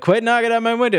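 high, mock-annoyed protest. Gorka to the man knocking on his window, annoyed. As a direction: pitch it up, irritated.

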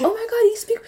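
high-pitched, gushing mock excitement; excited coworkers. Coworkers hear her French greeting, mock excited. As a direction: imitate over-impressed coworkers, pitch shooting up.

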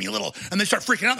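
ordinary talk

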